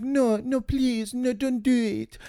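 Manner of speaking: soft, high-pitched plea; meek pleading woman. Retold or imitated. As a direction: imitate her begging in a small, high voice. Acts out woman pleading in film.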